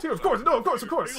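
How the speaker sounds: high, falsely pious assent; hasty agreement. Acted. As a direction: fall over each other agreeing, half laughing. Group hastily agrees about their god.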